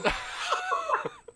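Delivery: high, whooping burst of laughter; big laugh. Real, not acted. Laughing about his Queen theme song, whooping laugh.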